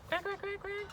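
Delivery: tiny, very high soft quacks; soft squeaky duckling chatter; little duckies. Acted. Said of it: "the little duckies go".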